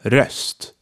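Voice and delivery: Röst, short half-open ö, repeated softly. Shown as a demonstration. Teacher repeating Röst for learners, clear short ö. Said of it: "the short half opened ö".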